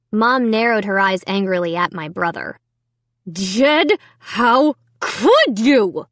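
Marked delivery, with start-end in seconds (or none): 3.3-6.1 very slow, pressed demand; through clenched teeth. A narrator's reading. Mom demanding an answer from her son, through clenched teeth. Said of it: "she demanded through clenched teeth".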